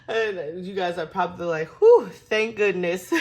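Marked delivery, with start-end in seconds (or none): 1.8-3.1 loud, slow mock sigh of relief. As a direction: let it out like a big relieved whew. Viewers imagined sighing in relief.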